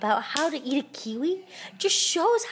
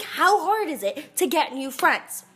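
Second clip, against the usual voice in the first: usual speaking voice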